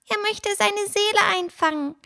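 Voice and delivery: high, tiny, scared squeak; trembling voice. A narrator's reading. Frightened bird explains the wolf to Fine, a tiny trembling voice. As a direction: explain it fearfully in a little voice. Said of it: "mit zittriger Stimme".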